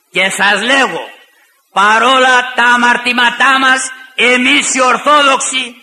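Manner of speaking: fiery sermon climax; preaching at full force. Acted. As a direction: proclaim it to the congregation.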